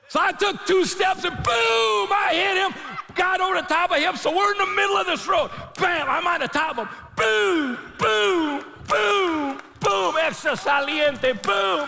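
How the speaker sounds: very high, loud fight story; preacher retelling the fight, shouting; excited shout. Retold or imitated. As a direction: shout it like you're winning the fight. Fight retold at full force.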